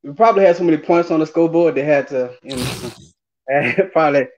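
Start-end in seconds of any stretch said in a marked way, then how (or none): none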